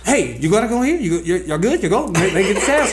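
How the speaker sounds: high, loud, bustling teacher checking on everyone; student imitating teacher's checking-in, high and bustling; impression of Miss Stephanie. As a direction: go up high and busy, herding kids like a caring teacher. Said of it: "It's a spot-on impression".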